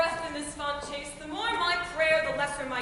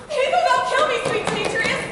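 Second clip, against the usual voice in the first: high, loud plea with a laugh; breathless cry after a fleeing lover; desperate calling; 7 semitones higher, 8 dB louder than usual. Acted. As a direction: call after him as he flees. Helena chasing Demetrius.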